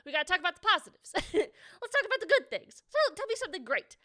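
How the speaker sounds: rally the chat toward good news; hyped up. Real, not acted. Streamer urging chat to share good news, loud and fast.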